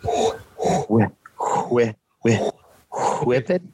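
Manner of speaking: "whip" with a long blown HW; trying out a puffed start on "whip"; attempted airy wh. Shown as a demonstration. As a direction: huff before each "whip". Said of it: "I can't pronounce it like you guys".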